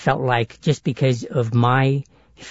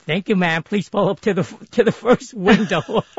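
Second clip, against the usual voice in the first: lightly voiced higher drive-thru worker; drive-thru lady; 7 semitones higher than usual. Drive-thru lady answering the customer, lightly imitated in higher voice. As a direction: imitate a polite order-taker, a little higher. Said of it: "it would be the same thing".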